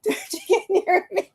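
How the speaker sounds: laughing through a high, mock-scared plea. Retelling the scared friend, laughing mock fright.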